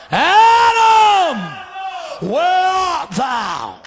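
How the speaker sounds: loud high call, then a very slow question; stern call. Acted. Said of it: "his tone changes".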